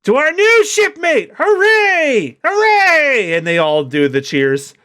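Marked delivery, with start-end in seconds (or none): 0.0-3.3 high, loud, slow cheer; cheering crew. Acted. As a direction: whoop it up for the new shipmate. Crew cheering the new doctor.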